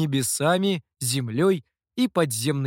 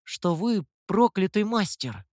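put-on naive boy; 5 semitones higher than usual. A narrator's reading. Boy bluntly repeats gossip.

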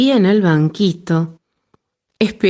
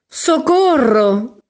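high, slow cry for help; frightened cry; 7 semitones higher, slower than usual. A narrator's reading. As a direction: call out for help in panic. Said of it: "gritaron los animales".

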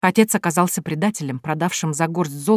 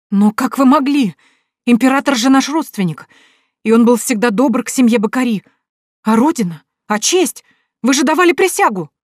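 high, pleading, rising indignation; a daughter protesting betrayal; outraged disbelief; 5 semitones higher, 3 dB louder than usual. A narrator's reading. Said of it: "не в силах поверить в предательство".

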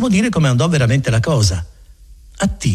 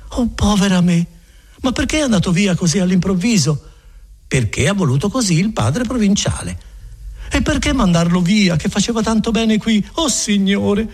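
a lightly voiced worried woman, higher; dismayed Agnese; 8 semitones higher than usual. Agnese lamenting to the friar, mildly distressed. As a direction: plead with rising distress.

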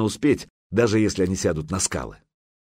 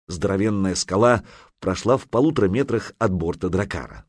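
plain reading voice

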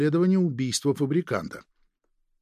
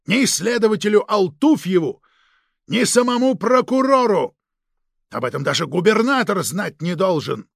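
raised voice, higher pitch, dressing him down; stern angry reprimand; 6 semitones higher, 5 dB louder than usual. Acted. Chief scolds an agent for leaking secrets, angry and forceful. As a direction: lay into him with loud, forceful emphasis.

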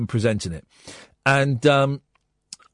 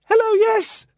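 high fake caller voice; slower than usual. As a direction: squeak out a bad Farage impression. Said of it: "this voice went".

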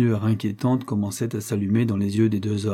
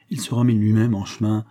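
ordinary delivery

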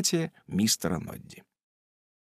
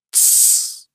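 шиканье. Acted. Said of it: "он сказал".

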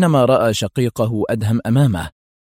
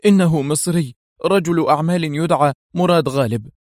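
high, bitter reply; 7 semitones higher than usual. A narrator's reading. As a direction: answer bitterly, pitch raised. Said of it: "أجابه أحمد في مرارة". (مرارة بنبرة مرتفعة)

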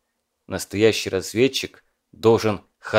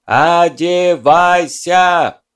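slow, drawn-out bellow, much louder; bellowing through cupped hands; 7 semitones higher, 9 dB louder, much slower than usual. A narrator's reading. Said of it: "сложил ладони в рупор и проорал".

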